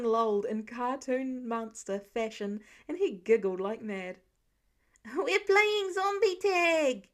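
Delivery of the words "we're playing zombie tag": put-on high, loud, slow child's holler; hollering kid. A narrator's reading. Small boy hollers to his parents, loud and high. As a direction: holler it out like a giddy little boy. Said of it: "he hollered".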